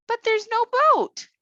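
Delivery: put-on tiny girl pointing out a problem; little girl voice. Thumbelina worries about boat.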